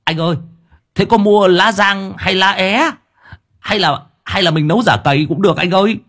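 put-on high, eager voice of a simple young helper; dim servant asking. A narrator's reading. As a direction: chirp it up high like a clueless lad. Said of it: "Thằng Sáu Bưởi lại hỏi".

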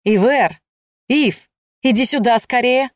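eager summons across a crowd; calling out. A narrator's reading. Mel calls his wife over from the crowd, calling out.

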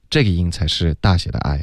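normal talking voice

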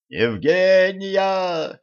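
high, very long-drawn call; shouted name. A narrator's reading. Елисей calling to captive Евгения, shouting. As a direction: call her name across a distance. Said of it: "крикнул Елисей".

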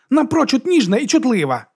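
high, defiant announcement; excited high-pitched boast; exclaiming. A narrator's reading. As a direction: proclaim it to everyone, pitched high. Drunk monk keeps praising his lover.

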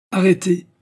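high, loud abrupt order; harsh, forceful halt; rough command. A narrator's reading. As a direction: order them roughly to stop. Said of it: "dit rudement Serganov".

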